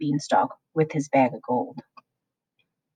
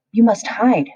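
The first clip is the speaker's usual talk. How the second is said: cook crying out, higher and louder; urgent cry; 6 semitones higher, 6 dB louder than usual. A narrator's reading. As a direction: call out the warning in a hurry. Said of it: "she cried".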